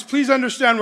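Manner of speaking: ordinary talk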